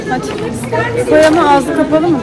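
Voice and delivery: yüksek sesle, tiz ve coşkulu; çocuğu neşeyle yönlendir; heyecanlı. Real, not acted. Çocuklarla heyecanlı konuşma. (tiz, coşkulu sesle çocuğa seslenme)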